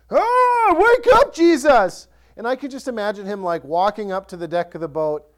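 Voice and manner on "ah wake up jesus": high, loud frantic shout to wake him; desperate yell of terrified disciples; panicked cry. Retold or imitated. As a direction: scream it like sailors about to drown.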